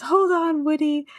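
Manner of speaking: high-pitched, lightly acted urging; urgent Buzz call. A narrator's reading. Buzz urges Woody as Scud pulls, mock urgent call. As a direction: call out a hammed-up plea to hang on. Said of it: "Buzz says".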